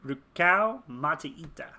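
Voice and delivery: slow, voiced, punched RUKAU; trying out a name. Real, not acted. Player testing a new character name, slow and aloud. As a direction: sound out the name aloud, slowly.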